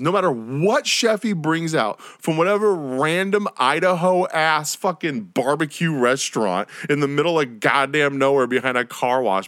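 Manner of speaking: ordinary talk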